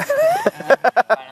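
laughing. Real, not acted.

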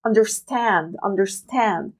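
"understand" with the last syllable "stand" long and stressed. Shown as a demonstration. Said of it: "عم بلفظه شوي بشكل أطول". (الضغط على المقطع الأخير stand)

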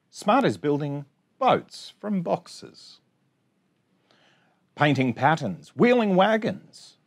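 bouncy sing-song rhyme reading; reading to children. Acted. Teacher reading a rhyming picture book to her class, sing-song. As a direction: read the rhymes out brightly for little kids.

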